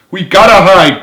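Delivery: high, loud urgent cry; panicked cry. A narrator's reading. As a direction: shout the warning in alarm. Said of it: "cried Frank".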